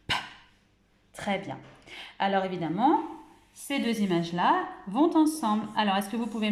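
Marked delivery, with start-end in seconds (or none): 0.1-0.2 the bare consonant "p." said alone, short and crisp; isolated sound p. Shown as a demonstration. Teacher to young pupils showing the first sound, isolated p. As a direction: sound out just "p." by itself, no vowel. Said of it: "on entend en premier le son".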